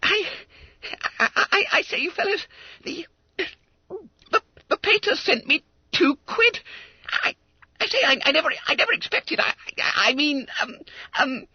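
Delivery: very high, halting schoolboy squeak; flustered stammering. Acted. Fat schoolboy to his fellows on getting money, flustered. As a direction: fumble for words in shock. Stunned Bunter fumbles for words.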